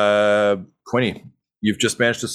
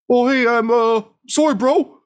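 much higher, loud and quavering apology; a tearful brother saying sorry, voice shaking; trembling voice; 5 dB louder than usual. Acted. Brother about to stab his brother, tearful and trembling. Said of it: "in a trembling voice says".

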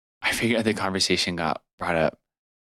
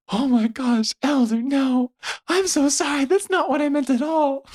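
much higher, louder, panicked rush of sorry; flustered companion begging forgiveness, high and loud; frantic apology; 4 dB louder than usual. Retold or imitated. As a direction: squeak out a horrified apology.

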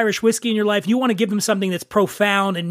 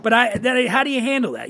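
ordinary talk

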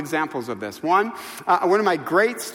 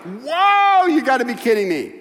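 spontaneous amazement; 6 semitones higher, 6 dB louder than usual. Acted. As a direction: blurt it out in acted astonishment. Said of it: "it just comes out".

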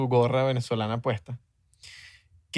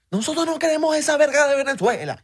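very high, loud Zulian caricature demanding; co-host plays Zulians demanding their own flag; mock maracucho; 12 semitones higher, 4 dB louder than usual. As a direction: insist on Zulia like a proud maracucho. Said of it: "maracucho que Alemania versus Zulia".

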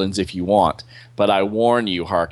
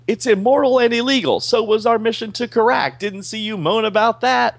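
hammed-up angry soldier, higher; mild mock yell; 12 semitones higher, 3 dB louder than usual. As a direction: fire back at your commander. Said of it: "he's screaming at her".

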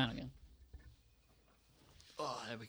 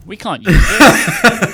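laughing; 7 semitones higher, 13 dB louder, much slower than usual. Real, not acted. Laughing at title suggestion.

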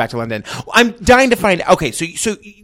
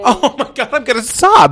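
gushing, higher-pitched reaction; overwhelmed reaction; 5 semitones higher than usual. Real, not acted. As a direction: react as if about to cry, pitch raised. Listener moved by the story.

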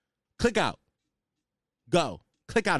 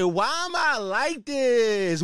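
mocking girl voice; 5 semitones higher, much slower than usual. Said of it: "fall on the ground and laugh and say".

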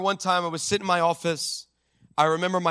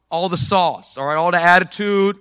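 ordinary delivery

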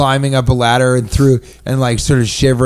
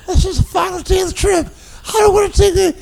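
angry shout; faster than usual. Retold or imitated. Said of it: "I'm pretty sure it was full volume and anger".

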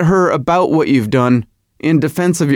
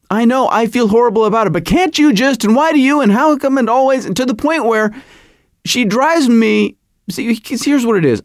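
higher-pitched, flustered marital protest; exasperated arguing; 7 semitones higher than usual. Retold or imitated. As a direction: cut yourself off in a rising rant. Acting out exasperated marital argument.

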